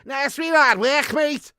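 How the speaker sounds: put-on high, loud, exasperated Scouse accent; proper Scouse. Scouser repeating himself to a baffled listener, loud thick Scouse. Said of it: "I've met some proper Scouse".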